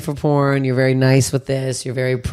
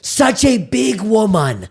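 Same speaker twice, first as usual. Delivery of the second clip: homeless man's catcall; 7 semitones higher, much slower than usual. Retold or imitated. Homeless guy heckling her outside the Comedy Bar, high mock bellow. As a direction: belt out a jolly ho ho ho, then drawl the rest. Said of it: "looks right at me and goes".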